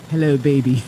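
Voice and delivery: low, smooth cool-guy voice; imitating a cool, deep-voiced man; cab driver's cool voice. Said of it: "He had a really cool voice".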